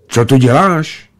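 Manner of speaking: a hint of a child demanding an answer; second child's voice. A narrator's reading. Second boy questions the stranger from the bushes, light child's voice. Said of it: "otázal se druhý dětský hlas".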